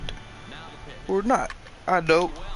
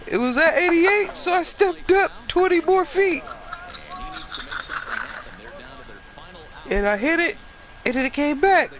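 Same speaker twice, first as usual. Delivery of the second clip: much higher, strained squeak after a nutshot; high squeaky injured voice; 13 semitones higher than usual. Pretend. To the doctor, telling the batting cage story in a squeak. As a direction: squeak it out like your nuts just got hit. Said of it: "That's why I'm talking like this".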